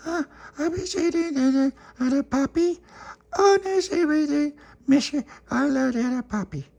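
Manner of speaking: put-on very high squeaky baby voice. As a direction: talk like a tiny toddler, way up high.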